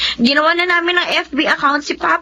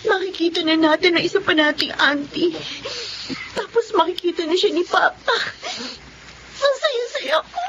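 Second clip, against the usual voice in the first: crying for joy. Acted. Sister about to meet long-lost aunt, crying for joy. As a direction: let happy tears take over, pitch rising. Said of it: "Umiiyak ka na kagad".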